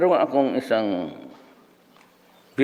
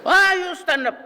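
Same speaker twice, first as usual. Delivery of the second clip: shrill, much higher angry professor voice; 3 dB louder than usual. Retold or imitated. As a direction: scold the student in a shrill high voice. (matinis at galit na boses ng propesor)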